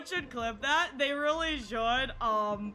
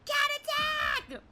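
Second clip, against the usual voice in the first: high, slow, loud yelp; playful yelp; 5 dB louder, much slower than usual. Real, not acted. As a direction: yelp it out dramatically. Cat jumped at her face.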